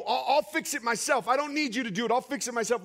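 stubborn mock refusal; defiant self-reliance. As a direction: brush off the help, pitched high and emphatic. Acted refusal of help.

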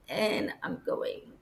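usual speaking voice

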